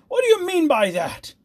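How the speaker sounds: high, loud tyrant's tirade; fierce. A narrator's reading. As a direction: rage and bully him into drinking. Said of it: "cried the tyrant fiercely".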